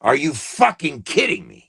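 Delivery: outraged repeat. Real, not acted. Repeats it with more force.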